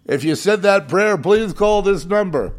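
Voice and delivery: mocking TV preacher. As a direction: ham up the TV call-in plea. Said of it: "that prayer with Franklin Graham on T V".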